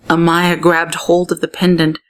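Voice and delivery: usual speaking voice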